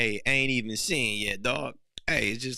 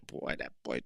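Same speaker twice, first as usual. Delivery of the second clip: whisper; 11 semitones lower, 6 dB quieter than usual. Pretend. Eyeing a passing woman.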